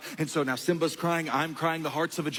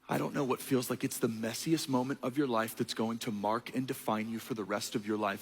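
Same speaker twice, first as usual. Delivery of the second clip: usual speaking voice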